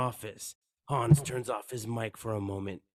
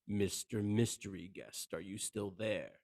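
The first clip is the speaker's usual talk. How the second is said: soft taunt; 3 semitones lower, 7 dB quieter than usual. Acted. Hans taunting over radio.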